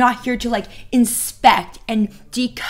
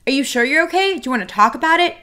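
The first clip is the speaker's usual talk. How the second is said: high, insistent questioning; pushy concern; 6 semitones higher, faster than usual. Acted. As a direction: keep pressing him to open up. Acting out pushy repeated questioning.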